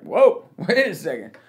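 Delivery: loud, comic double take; mock alarm, loud; taken aback. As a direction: blurt a startled protest, playing it for laughs. Reacts to retold death threat.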